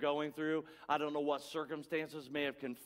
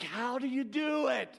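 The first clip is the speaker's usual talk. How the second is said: higher, wondering question after a laugh; amazed; 7 semitones higher than usual. Retold or imitated. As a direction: marvel at your mother's knack. Amazed at mother's intuition.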